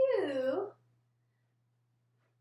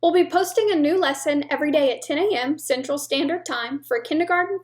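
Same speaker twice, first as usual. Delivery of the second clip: brisk, louder outro read; announcement read; 4 semitones lower, 5 dB louder, faster than usual. Acted. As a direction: rattle off the sign-off like an ad.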